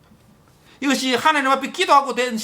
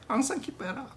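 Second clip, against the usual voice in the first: soft echo; 11 dB quieter than usual. Shown as a demonstration. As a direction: repeat it gently, almost to yourself. The same command repeated softly. (조용히 되뇌듯)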